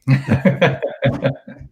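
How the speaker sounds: laughing. Real, not acted. Laughs at Scottish brogue joke.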